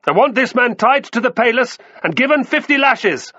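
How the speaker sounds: very high, loud shout; furious summons. A narrator's reading. Batiatus orders a slave flogged, shouting furiously. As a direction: shout for the guards in a rage. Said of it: "He waved his arm in furious summons".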